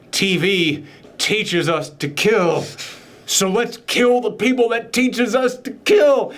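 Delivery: mock fervent proposal. Cult member urges killing TV stars, slow mock fervour. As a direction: build slowly to a hammed-up battle cry.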